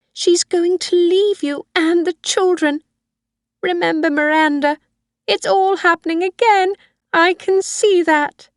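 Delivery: put-on high, loud, fretful old woman pleading with her son; Missus Harvey, frightened old mother. Old mother voiced throughout.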